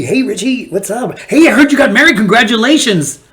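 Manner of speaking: high, nervous overfriendly hello; nervous high greeting. Retold or imitated. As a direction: squeak out a guilty, forced-cheerful greeting.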